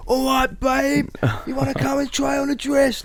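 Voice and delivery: high, cheeky Cockney street charm; Cockney accent. Retold or imitated. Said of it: "Like, doing Cockney".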